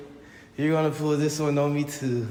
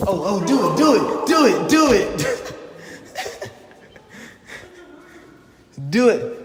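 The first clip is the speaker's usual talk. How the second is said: excited shouting; 9 semitones higher, 8 dB louder than usual. Real, not acted. Urging player on.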